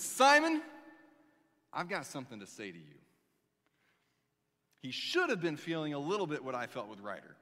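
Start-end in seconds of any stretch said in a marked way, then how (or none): 0.0-3.0 dramatic call. Acted. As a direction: boom out the name, then rush the line. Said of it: "when Jesus looks at him and says".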